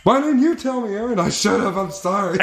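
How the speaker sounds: high, lightly mocking girlfriend voice.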